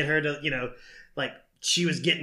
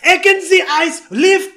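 loud, high mock-German bark; German accent; 13 semitones higher, 12 dB louder, slower than usual. Kid reading the helicopter German, shouted mock-German accent. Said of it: "say in German".

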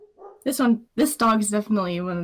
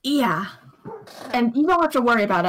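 normal talking voice

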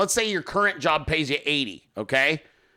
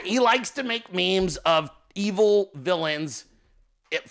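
ordinary delivery

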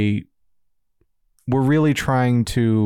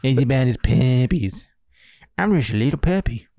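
cartoon dog. Silly puppy voice quoting Parks and Rec.

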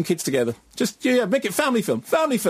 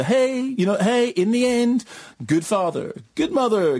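mawkish sentimentality; 6 semitones higher than usual. Retold or imitated. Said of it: "that mawkish, really sort of skin-crawling sentimentality".

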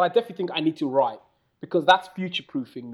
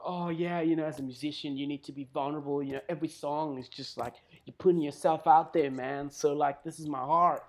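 mock earnest musician; 8 dB quieter than usual. Musicians preaching vulnerability to each other, softly imitated. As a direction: murmur it like an artist's familiar spiel. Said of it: "people always talk about".

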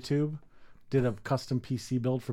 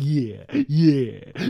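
spaced-out imitation; 5 dB louder, much slower than usual. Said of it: "He's sitting there like".

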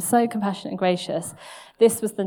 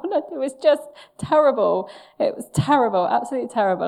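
usual speaking voice

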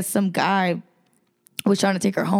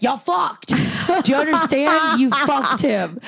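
exasperated inner yell; 6 semitones higher, slower than usual. Retold or imitated. Onlooker silently screaming at the pair, exasperated and higher. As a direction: spell it out to them in disbelief.

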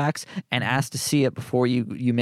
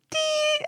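very high beep sound; mock electronic beep. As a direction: chirp it like a machine. Imitating the radio code beep.

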